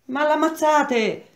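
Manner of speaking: high, slow cry of shock; horrified exclamation. Acted. Parigi crying out in horror as men beat Olindo. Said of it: "esclamò Parigi".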